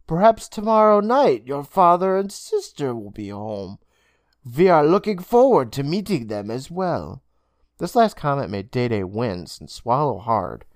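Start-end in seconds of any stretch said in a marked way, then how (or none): 0.1-7.2 Mister Braun. Acted. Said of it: "Mister Braun assured him".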